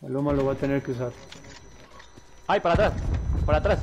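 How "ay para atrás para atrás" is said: loud, urgent yell to fall back; shouted warning. Real, not acted. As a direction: yell it out in alarm. Warning teammate in gunfight.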